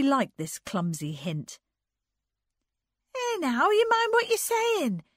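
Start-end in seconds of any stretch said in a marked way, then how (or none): 3.1-5.0 very high, admonishing cry; high-pitched scolding outburst. A narrator's reading. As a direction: warn him off in a high loud voice. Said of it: "cried Anne".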